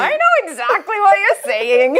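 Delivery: high, laughing gotcha line; laughing reveal. Retold or imitated. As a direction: sing it out, caught you, half laughing.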